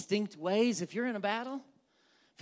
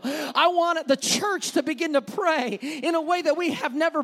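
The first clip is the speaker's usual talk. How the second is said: loud, raised, fervent preacher voice; full-force preaching; 5 semitones higher, 5 dB louder than usual. Acted. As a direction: proclaim God's promise to the church.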